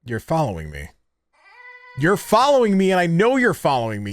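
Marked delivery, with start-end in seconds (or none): 2.0-4.1 louder, higher, accusing. Real, not acted. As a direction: call it out loud, alarmed. Realizes toy is following him.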